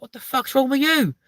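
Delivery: high, loud, incredulous yell; alarmed outburst. Retold or imitated. Retelling reply to ex screaming in the bath, alarmed outburst. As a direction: shout back in baffled alarm.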